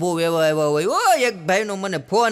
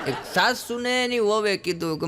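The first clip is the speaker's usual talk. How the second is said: ordinary talk, nothing marked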